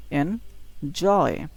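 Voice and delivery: Enjoy slowly, its last syllable জয় punched; enjoy with a long, stressed জয়; stress on second syllable. Shown as a demonstration. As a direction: split enjoy and lean hard on জয়. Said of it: "একটু জোরে বলতে হবে".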